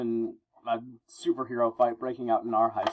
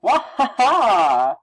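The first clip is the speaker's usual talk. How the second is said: high, loud "wah-ha-ha" cry; loud drawn-out wail; 10 semitones higher, 9 dB louder, much slower than usual. Acted. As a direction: let out a long comic-book yell. Reading a yell in the comic.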